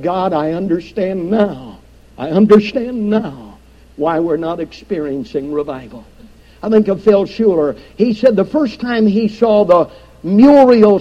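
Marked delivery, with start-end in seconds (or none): 0.0-6.0 hushed, much softer, lower confession; broken man's confession. Retold or imitated. Preacher voicing a pastor broken before God, hushed and low. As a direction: let it fall to a broken near-whisper of surrender. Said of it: "a broken man said".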